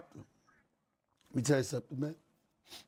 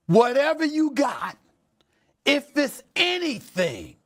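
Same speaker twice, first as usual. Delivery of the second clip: fiery shout; 10 semitones higher, 4 dB louder than usual. Real, not acted. Host's fiery lecture to players.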